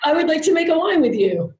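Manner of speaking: high, grand lady's voice with a laugh; high, laughing put-on voice of a grand society lady; Baroness de Rothschild. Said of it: "And I said".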